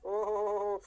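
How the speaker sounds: long rolling "oho" of realisation; drawn-out "oho-ho". Acted. Teacher reacting to the photoelectric reversal, long drawn-out "oho". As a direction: exclaim a stretched-out "oho-ho-ho".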